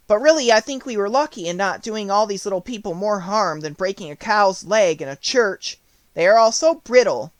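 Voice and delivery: lightly voiced girl Dorothy; Dorothy's voice. A narrator's reading. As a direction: voice Dorothy as a bright little girl.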